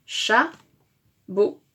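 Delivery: "chabot" in two halves, "cha", pause, "bot"; split into syllables. Shown as a demonstration. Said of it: "Première syllabe de chameau".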